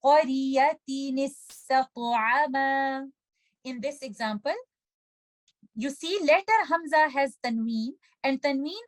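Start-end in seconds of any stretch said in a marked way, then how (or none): 0.0-3.1 قرية استطعما joined with a kasra, with a bounced ta; recitation. Shown as a demonstration. Teacher recites the joined phrase for students. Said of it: "recite this word like this".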